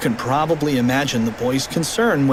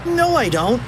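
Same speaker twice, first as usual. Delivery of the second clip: put-on high kid voice; 10 semitones higher than usual. Character replies.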